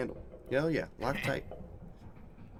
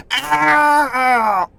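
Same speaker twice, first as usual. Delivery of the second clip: loud high cry of pain; pained yelp; 13 semitones higher, 12 dB louder than usual. Real, not acted. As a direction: yelp as fingers get caught. Fingers crushed in cog.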